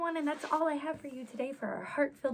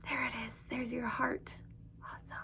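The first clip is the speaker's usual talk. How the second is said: whisper; 6 dB quieter, faster than usual. Acted. Hushed praise to kids.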